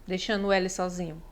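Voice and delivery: ordinary delivery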